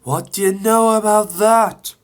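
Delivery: high, loud teasing question; Percy gloating. Acted. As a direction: tease Thomas gleefully.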